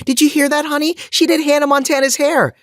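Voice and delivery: very high-pitched gushing mom voice; mock-thrilled, sky-high exclamation; excited exclaiming mom. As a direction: imitate a mom squealing the news to her family. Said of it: "and exclaim".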